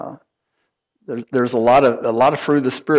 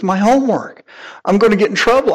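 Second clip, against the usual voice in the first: put-on a scared kid sobbing about his homework, pitched up; crying son; 9 semitones higher, faster than usual. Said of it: "he's crying".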